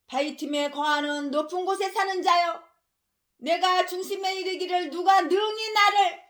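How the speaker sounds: slow, weighty recitation of the verse; slow pulpit scripture reading, rising toward the end; solemn scripture reading. Acted. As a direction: intone the prophecy from the pulpit. Reads Obadiah scripture.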